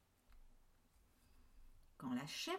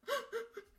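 frightened gasp. Acted. Terrified kids.

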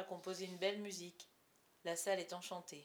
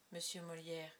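plain reading voice

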